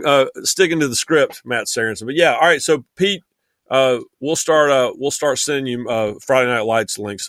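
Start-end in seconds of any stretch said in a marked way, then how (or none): none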